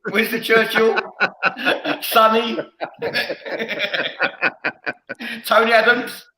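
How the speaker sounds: laughing through the name. Real, not acted. Host laughing while naming famous speech givers. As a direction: chuckle the name out, amused. Laughing at own joke list.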